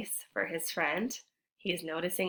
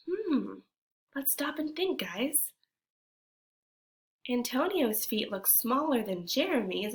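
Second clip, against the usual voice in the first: usual speaking voice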